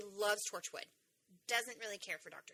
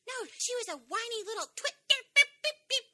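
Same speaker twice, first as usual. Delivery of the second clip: much higher and louder, ranting with mock censor beeps; mom's hysterical rant; 14 semitones higher, 11 dB louder than usual. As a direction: play the livid mom, mocking, and beep out the swearing. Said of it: "hysterical rantings of a mom".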